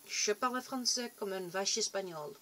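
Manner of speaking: a clumsy English speaker's French accent; an English speaker's hesitant, self-mocking French. Shown as a demonstration. Vlogger showing off bad French, English accent. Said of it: "From pronunciation sucks".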